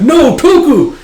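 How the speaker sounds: high, loud cry for a comrade; dramatic alarmed yell; distressed shout. Acted. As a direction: call out in acted horror. Thug cries out for comrade.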